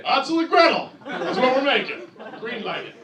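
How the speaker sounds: loud, high mock studio boss; Hollywood executive. Hollywood boss green-lighting a children's book movie, loud mock pitch. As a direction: pitch it with overblown conviction. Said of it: "Here, I'm Hollywood".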